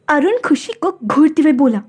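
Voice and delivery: plain reading voice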